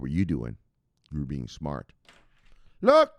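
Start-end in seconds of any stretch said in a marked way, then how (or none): 2.8-3.1 high, loud attention call. Real, not acted. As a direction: snap them to attention. Calling the viewers' attention.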